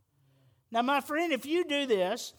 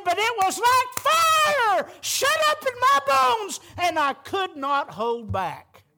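high, loud, slow shout of the prophet's words; Jeremiah's outcry; 8 semitones higher, 5 dB louder, slower than usual. Retold or imitated. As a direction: cry out the fire in the bones, much higher and louder, slowly, then settling. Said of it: "But then he said".

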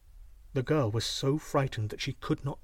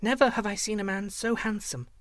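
put-on high-pitched, polite child; little girl's voice; 7 semitones higher than usual. A narrator's reading. Slave girl reassures the young man, high little-girl voice. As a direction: answer respectfully, pitched well up. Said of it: "she replied".

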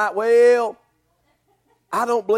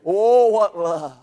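awed wonder; 2 semitones lower, 4 dB louder, much slower than usual. Real, not acted. Awed exclamation after climax.

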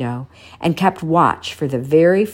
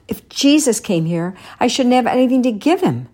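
put-on higher, quicker old man, mildly rueful; a lightly voiced old man lamenting; old shoemaker's regret; 9 semitones higher than usual. A narrator's reading. Shoemaker thinking of a gift for Jesus, mild regret. Papa Panov regrets aloud.

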